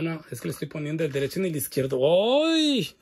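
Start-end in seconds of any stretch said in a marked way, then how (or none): 2.4-2.9 sudden high, louder yelp; startled yelp. Real, not acted. Host catching her own mistake, startled yelp. Putting shoe on wrong foot.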